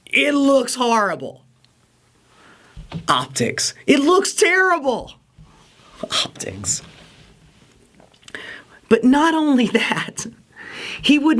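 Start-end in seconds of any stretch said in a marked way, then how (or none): 3.1-3.7 mocking the buzzword. Shown as a demonstration. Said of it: "people keep talking about the bad optics". 6.0-6.8 whispered buzzword. Shown as a demonstration. Host mocking pundits' favourite word, whispered. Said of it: "people keep talking about the bad optics".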